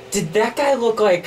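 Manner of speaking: excited. Acted. Excited shared recognition of lookalike.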